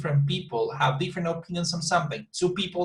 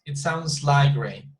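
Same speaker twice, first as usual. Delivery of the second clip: slow staircase tune peaking on "like"; stress on like; 3 dB louder, slower than usual. Shown as a demonstration. Teacher reminds student of the staircase, slowly stressing "like". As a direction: punch and stretch "like", falling away on "rain". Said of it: "Remember the staircase".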